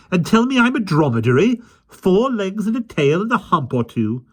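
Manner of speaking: high, loud outraged nanny; loud indignant scolding; stern accusation. A narrator's reading. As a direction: fling it at him indignantly. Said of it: "she accused him sternly".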